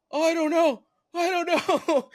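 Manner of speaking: high, loud, bewildered; in-shock kid, high and a bit loud; dazed kid. Retold or imitated. In shock after breaking arm.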